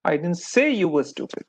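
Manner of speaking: emphasis landing hard on "say"; stress on SAY. Shown as a demonstration. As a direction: punch the word "say", let the rest fall away. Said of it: "The emphasis of the word".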